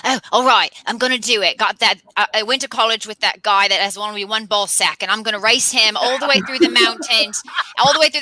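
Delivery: ordinary delivery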